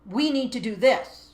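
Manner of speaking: loud, commanding and corrective; sharp parental scolding; stern parent voice. Shown as a demonstration. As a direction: bark orders like a cross parent. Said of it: "what we call the parent voice".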